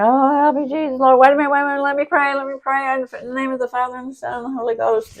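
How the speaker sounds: crybaby sister praying to dodge a whooping; hammed-up panic. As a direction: beg and pray in mock terror.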